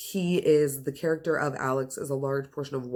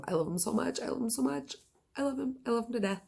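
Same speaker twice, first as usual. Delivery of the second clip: gushing squeal; 9 semitones higher, 5 dB quieter, faster than usual. Real, not acted. Gushing over her fictional crush.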